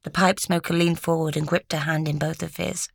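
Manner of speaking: usual speaking voice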